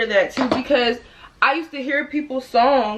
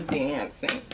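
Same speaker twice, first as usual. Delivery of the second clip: "dancing" with an American short a; American accent; 6 semitones lower, 8 dB quieter than usual. Shown as a demonstration. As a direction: say "dancing" the American way, low and soft. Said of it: "He don't say".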